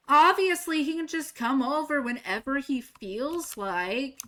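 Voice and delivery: mock the friend's reading of it; mock sarcastic quote. Mocking friend's take.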